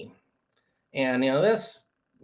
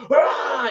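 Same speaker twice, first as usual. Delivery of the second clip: high, loud mock holler; imitated yell; 10 semitones higher, 5 dB louder than usual. Man yelling downstairs at girlfriend, imitated yell. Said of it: "he'll be yelling out his sentence".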